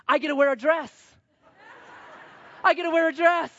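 put-on high-pitched gleeful kid voice; excited little boy. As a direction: chant it like a delighted toddler. Son thrilled about dressing up.

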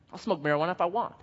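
quick, offhand mock defiance; imitated careless defiance. Unrepentant person defying the pastor, quick careless tone. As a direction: toss it off like nobody can object.

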